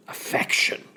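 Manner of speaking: whispery snort of disbelief; scornful snort. Acted. Polonius scoffing at his daughter's claim, snorting. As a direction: snort the word out, voiceless. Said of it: "Polonius snorted".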